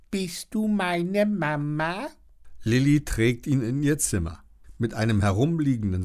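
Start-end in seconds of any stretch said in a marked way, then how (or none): 0.1-2.1 slow, high little-creature voice asking; a newborn dino's timid question; baby dino voice. Acted. Baby dinosaur asks Lilly, slow and high. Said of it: "als wollte er sagen".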